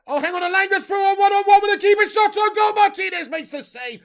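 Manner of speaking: high, loud urgent football call; excited play-by-play. Real, not acted. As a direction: shout the chance as it unfolds. Live call of a chance.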